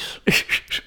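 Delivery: brief unvoiced-sounding chuckle; short laugh. Real, not acted. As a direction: let out a little real laugh. Laughs after criticising the accent.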